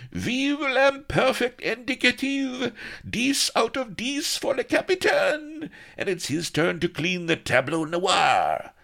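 loud very high jubilant crowd; cheering. A narrator's reading. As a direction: cheer the captain, laughing. Crew cheering their captain.

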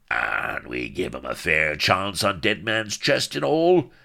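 rising roar of injured protest; pirate bellow. A narrator's reading. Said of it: "bellowed Firebeard".

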